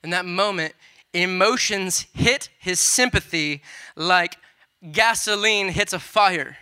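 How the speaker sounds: loud, fiery preaching that keeps building; preaching at full force. Acted. Preacher to his church on Jesus weeping, loud full-force preaching. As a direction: thunder it out to the congregation.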